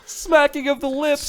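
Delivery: high, drawn-out mock echo. Co-host parrots a phrase from the ASMR list, mocking high voice.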